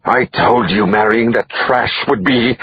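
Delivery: high, slow, drawn-out words that collapse; furious scream breaking off. Acted. Grieving wife raging at her husband, high slow scream breaking off. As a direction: rage that gives way mid-sentence. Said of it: "she screamed at her husband".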